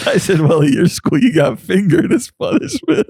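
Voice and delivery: high pitched words through laughter; laughing. Real, not acted. Repeating the joke to friends, laughing.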